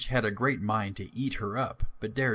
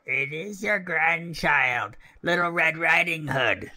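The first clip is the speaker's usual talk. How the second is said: put-on girlish voice, raised and slow; wolf imitating little girl; 7 semitones higher, 4 dB louder than usual. A narrator's reading. Wolf posing as the grandchild at the door, imitating a little girl. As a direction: pose as a little girl, pitched up and louder. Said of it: "speaking like a little girl as well as he could".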